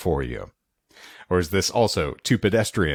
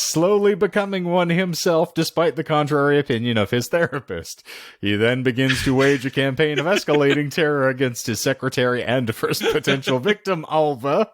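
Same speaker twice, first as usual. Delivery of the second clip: higher-pitched theatrical plot read; dramatic movie-blurb delivery; synopsis read; 7 semitones higher than usual. Acted. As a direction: announce the plot like a film presenter. Reads out a film synopsis.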